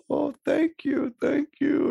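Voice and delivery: put-on high, slow Eastern European accent; imitated Eastern European man. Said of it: "he's got, like, a Eastern European accent".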